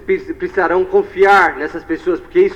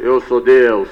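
low, solemn God imitation; big voice of God; 7 semitones lower, much slower than usual. Interpreter as God revealing himself, deep voice. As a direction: play God, pitched well down. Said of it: "uma voz bem forte".

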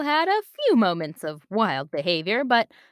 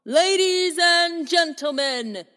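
slow, grand ringmaster announcement; booming ringmaster; 4 semitones higher, slower than usual. Acted. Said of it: "Mister Reynolds' booming voice".

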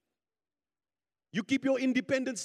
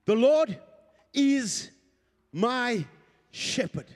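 raised, emphatic preacher's declaration; forceful preaching; 3 semitones higher, 5 dB louder than usual. Real, not acted. Preacher declaring God's favor upon the congregation, forceful preaching. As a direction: proclaim each blessing over the congregation.

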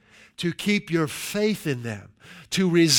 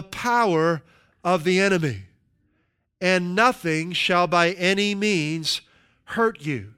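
slow, louder, weighty reading; emphatic scripture reading; 2 semitones higher, 4 dB louder than usual. Acted. Preacher proclaiming Jesus' promise of power, slow and emphatic. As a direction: lean on every phrase, slow and strong.

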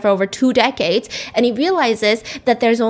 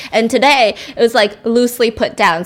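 ordinary delivery

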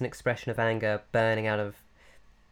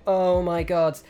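loud, higher "Oh my god"; mock shock; 8 semitones higher, 9 dB louder, slower than usual. Character facing a leaping brain, startled. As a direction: gasp it out, startled.

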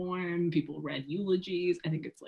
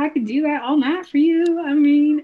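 higher, hammed-up Southern accent; put-on Southern accent; 4 semitones higher, 6 dB louder than usual. Doing the rest of the podcast Southern on request, put-on Southern accent. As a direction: lay on a playful Southern accent. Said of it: "that Southern accent that you did".

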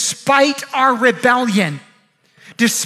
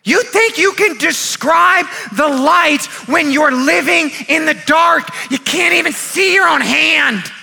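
preaching at full force; 2 semitones higher than usual. Real, not acted. Preacher rebukes doubters who blame God, preaching at full force. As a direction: thunder each phrase at the congregation.

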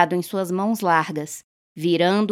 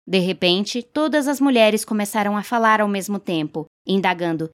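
usual speaking voice

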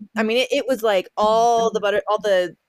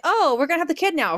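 higher, fast, breezy announcement; her body speaking; 8 semitones higher, faster than usual. Retold or imitated. Her body announcing labor, a higher fast breezy voice. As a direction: rattle it off brightly and suddenly. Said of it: "my body's like".

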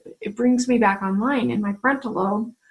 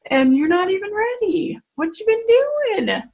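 high, louder-edged mock reproach; imagined divine scolding; 9 semitones higher, 3 dB louder than usual. Speaker imagining God questioning her readiness, mock scolding. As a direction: chide as an imagined God, drawing out the last question. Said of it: "like maybe God is thinking".

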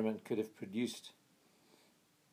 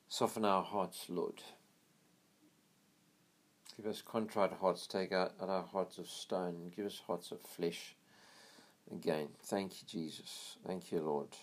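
low, hushed prayer, much softer; quiet prayer; 3 semitones lower, 5 dB quieter than usual. Real, not acted. Preacher pausing the story to pray for soft hearts, hushed and low. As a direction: pray softly to the Lord, almost under the breath.

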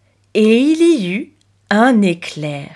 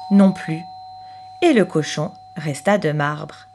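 ordinary delivery

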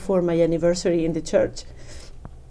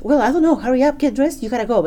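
higher, louder mock hurry; hurried mom; 6 semitones higher, 4 dB louder, faster than usual. Mom evading question, hurrying daughter, higher and louder. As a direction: push her out the door, quick and insistent. Mom rushing her to church.

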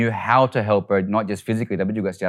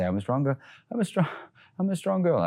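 soft coaching; 7 semitones higher, 7 dB quieter than usual. Shown as a demonstration. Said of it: "Just say".

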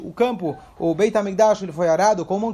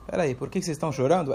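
softer, fast and lower, a knowing answer rather than a question; softer knowing reply; 5 semitones lower, 6 dB quieter, faster than usual. Retold or imitated. Rabi Akiva quietly answers the weeping sages, softer, lower and fast. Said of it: "Ele está respondendo".

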